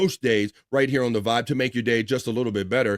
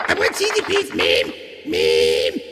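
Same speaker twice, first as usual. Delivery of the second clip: shrill whiny cartoon kid; Cartman voice; 9 semitones lower, slower than usual. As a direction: beg for cheesy beef like Cartman. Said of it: "Eric Cartman checking in".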